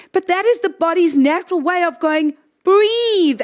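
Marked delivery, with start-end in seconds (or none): 2.6-3.4 high, insistent command; the body urgently ordering you; mild mock yell; mock scream. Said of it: "I'm screaming at you".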